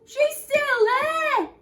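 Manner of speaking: very high, loud squeal; alarmed shout. Acted. Baby Bear spotting Goldilocks in bed, high squealing shout. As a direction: shriek in alarm.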